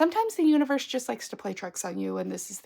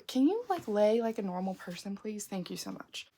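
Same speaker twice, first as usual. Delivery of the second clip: much softer, off-hand request; aside to pet; 9 dB quieter than usual. Real, not acted. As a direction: drop the volume for a private aside to the pet.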